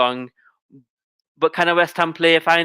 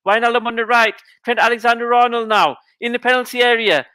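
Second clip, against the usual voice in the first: rising excitement; 7 semitones higher than usual. Real, not acted. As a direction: voice climbs higher and higher as the attack builds. Attack builds toward a goal.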